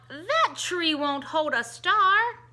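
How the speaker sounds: loud slow call from afar. Acted. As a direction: call it out across the room. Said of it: "cried out".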